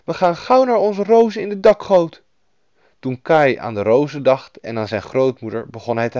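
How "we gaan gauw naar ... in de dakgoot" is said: put-on girl heroine. A narrator's reading. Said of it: "zei Gerda".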